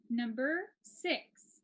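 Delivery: very slow, drawn-out announcement; going slow. Real, not acted. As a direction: announce it word by word, with a long gap. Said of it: "I'm going slow".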